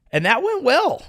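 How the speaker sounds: dry sarcasm, pitched high; sarcastic. Real, not acted. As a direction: say it mockingly, meaning the opposite. Sarcastic remark on the Iraq war.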